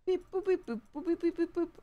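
high little beep-boop noises; short high imitated beeping; robotic beep-boops. Real, not acted. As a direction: chirp out idle computer beeps. Idle noises while playing.

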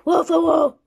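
loud playful woofs; dog barking. Pretend.

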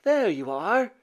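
high, lilting relieved greeting; relieved mother. A narrator's reading. Mother finding her son, relieved. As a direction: let relief lift the voice. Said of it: "looking relieved".

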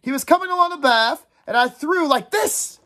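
put-on high, excited boyish retelling; attention-seeking. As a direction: show off to win the others back. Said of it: "tried again to get all the attention".

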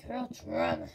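put-on "girl drama" dropped very low, falling; deep mock "girl drama". Shown as a demonstration.

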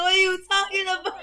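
loud shocked cry; shocked shout. Real, not acted. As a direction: yell it out in shock. Shocked at crude joke.

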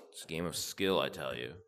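normal talking voice

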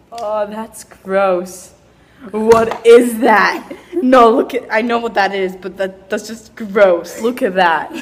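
loud, slow disgust; grossed out. Real, not acted. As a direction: drag out the disgust. Reacts to gross food.

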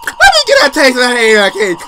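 high, loud hammed-up creepy ghost; mock the ghost in a shrieking put-on voice. Streamer imitates the ghost taunting him, hammed-up shriek. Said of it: "That ghost was like".

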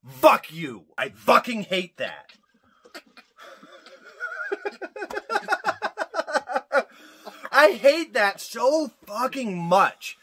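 loud, exasperated outburst; comic angry rant. Real, not acted. Host rants about a hated pronunciation, loud and exasperated. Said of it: "I'm gonna have a little rant".

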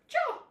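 high squeaky "Chop!"; chop sound effect. Pretend. Chopping vegetables, calling out.

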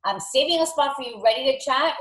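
peppy coach leaving an excited voicemail; super excited. Shown as a demonstration. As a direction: gush like you can't wait to see her. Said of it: "super excited".

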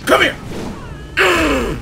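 high, loud call into a grunt; chasing shout. Real, not acted. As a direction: yell it, then grunt with the blow. Chasing an opponent.

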